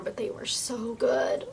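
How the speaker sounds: slow wistful whisper; longing whisper. Real, not acted. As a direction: whisper it like a craving. Longing whisper about cookies.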